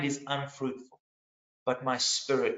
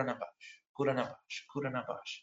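low, soft, tentative chant to himself; hushed repeating; 4 semitones lower, 9 dB quieter, faster than usual. Acted. Praying man repeating a new word over and over, hushed. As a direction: murmur the odd word over and over.

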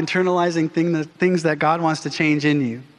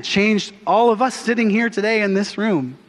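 ordinary talk, nothing marked

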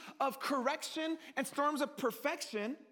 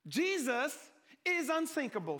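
ordinary talk

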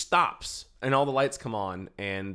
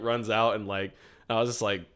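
normal talking voice

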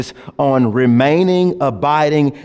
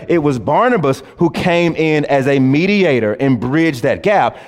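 normal talking voice

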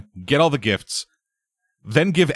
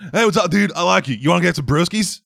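bro voice; 8 semitones higher than usual. Retold or imitated. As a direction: greet like a friendly bro. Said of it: "He's just kind of a bro".

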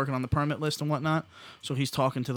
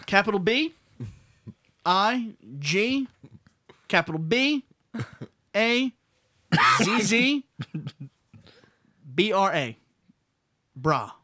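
higher, deliberate letter by letter; slow spelling out; 6 semitones higher, 4 dB louder, slower than usual. Retold or imitated. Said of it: "He's like".

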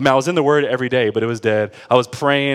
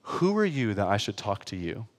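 low, hushed divine question; soft, grave reproof; God's quiet rebuke; 4 semitones lower, 9 dB quieter than usual. Retold or imitated. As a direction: deliver it softly and low, with weight. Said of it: "I felt God say to me".

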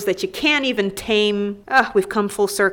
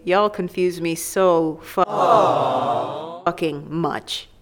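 drawn-out emphasis; 4 semitones lower, much slower than usual. Real, not acted. Frustrated emphasis.

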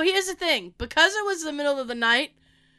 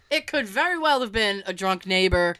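ordinary delivery

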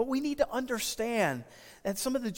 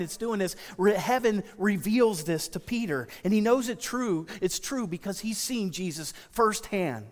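ordinary talk

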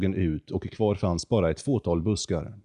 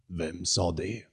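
the condor mimics it back, softer; mocking echo; 5 dB quieter, slower than usual. The condor mimicking Jonathan's frightened question, soft and mocking. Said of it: "härmade rösten".

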